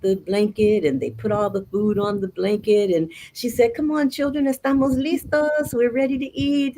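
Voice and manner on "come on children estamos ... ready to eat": high-pitched storybook mama; lightly acted motherly call; mama calling children. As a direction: call the kids over to the picnic. Mama calls children to eat.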